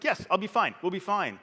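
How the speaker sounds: 高めの大きな声で自信たっぷりに; 何も心配していない父を真似て; dad's breezy confidence. Retold or imitated. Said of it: "It's like".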